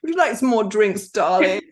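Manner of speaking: a hint of a posh English madame. Shown as a demonstration. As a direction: lightly purr it as a posh English club madame. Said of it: "get very posh".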